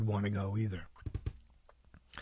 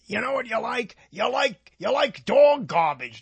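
put-on old-time New York saying; 9 semitones higher than usual. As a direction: put on a high-pitched New York character. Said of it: "They used to say in New York".

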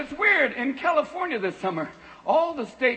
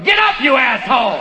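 louder laughing yell at a lazy owner; cat yelling; 8 dB louder than usual. Retold or imitated. Cat yelling at its stoned owner, louder. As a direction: shout it as the fed-up cat. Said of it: "your cat's going".

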